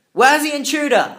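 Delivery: loud, high shout from a guard. Acted. Wall guard shouting into the dark for the intruder. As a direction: bellow it from the top of the wall. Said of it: "a masculine voice shouted".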